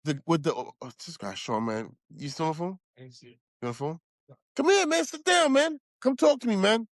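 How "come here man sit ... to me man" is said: calling out. Real, not acted. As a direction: call a friend over. Calling to Sean.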